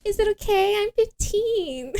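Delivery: high-pitched put-on young girl voice; decoy teenage girl. Imitating the decoy asking if her age is okay, high girlish voice. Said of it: "And she's like".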